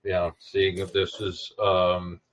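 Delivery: normal talking voice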